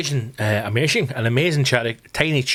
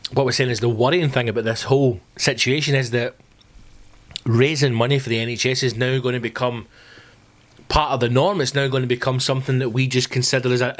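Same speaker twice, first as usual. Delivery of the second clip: usual speaking voice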